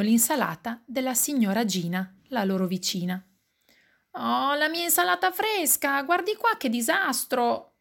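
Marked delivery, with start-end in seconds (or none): 4.1-7.8 high-pitched, dismayed neighbour; protesta. A narrator's reading. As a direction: wail in complaint, pitched high. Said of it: "protesta la signora". (lamentela acuta della vicina)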